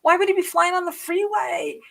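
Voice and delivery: high, insistent, panicky; frantic protest. Retold or imitated. Arguing with dad on the phone, high frantic protest. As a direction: argue back in alarm. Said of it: "I'm like".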